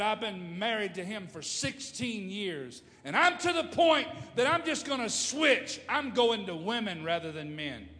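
high, loud outburst building to a shout; shouted retelling of an exasperated rant; imitated angry woman. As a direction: act out her fury, peaking midway. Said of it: "this young lady was so angry".

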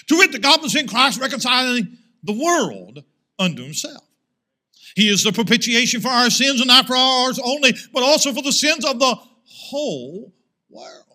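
loud, ringing Bible reading; forceful scripture reading. Acted. Preacher reads the verses on Christ's atonement aloud, loud and ringing. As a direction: proclaim each verse to the hall.

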